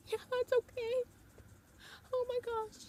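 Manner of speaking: very high, thrilled squeak; squealing yes. Retold or imitated. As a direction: squeak it out, barely containing joy. Fan agreeing to hug photo.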